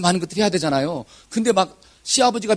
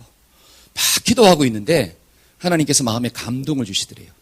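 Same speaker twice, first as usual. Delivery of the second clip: ordinary talk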